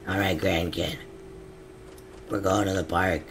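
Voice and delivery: ordinary talk, nothing marked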